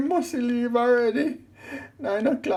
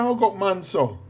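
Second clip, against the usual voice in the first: ordinary talk, nothing marked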